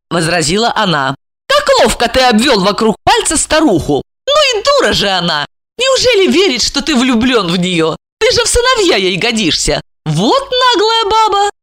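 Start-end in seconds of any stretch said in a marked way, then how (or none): 1.5-11.5 high female character voice, mocking; high-pitched catty contempt; scornful, gloating woman. Plays Regina mocking the old woman.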